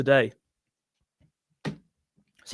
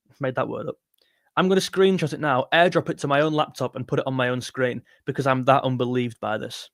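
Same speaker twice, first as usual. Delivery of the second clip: ordinary talk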